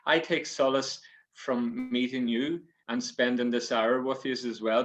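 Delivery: plain reading voice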